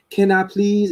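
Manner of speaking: timid, pleading little request; meek ask. Retold or imitated. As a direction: ask like you expect a no. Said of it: "Ask is".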